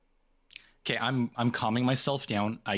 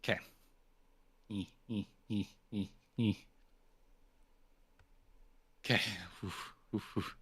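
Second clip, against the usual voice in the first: normal talking voice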